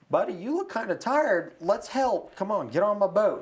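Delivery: high, loud urging call; acted hearty offer of help; second boater. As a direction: call out to a tired swimmer, coaxing him aboard. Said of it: "another boat eventually comes by".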